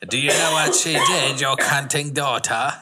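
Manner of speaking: exaggerated put-on character voice; Burke Denning. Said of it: "In Burke Denning's voice".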